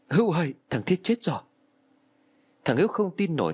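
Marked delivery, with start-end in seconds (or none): 0.1-1.4 higher-pitched urgent call. A narrator's reading. Friend bringing news of a death to Hữu, urgent calling out. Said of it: "Tôi liền gọi".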